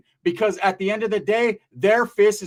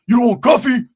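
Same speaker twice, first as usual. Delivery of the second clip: higher, much louder put-on voice; mock loud offer; 4 semitones higher, 9 dB louder than usual. Customer offering coffee as payment, loud mock voice. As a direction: blurt the offer out loudly as the customer.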